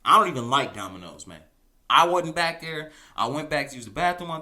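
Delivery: a mock-indignant coworker swearing they weren't there; demonstrative denial. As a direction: protest your innocence to the investigator. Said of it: "demonstrative in their answer".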